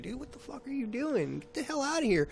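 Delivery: high-pitched mock yell at a stranger; retold yelling. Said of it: "I start yelling".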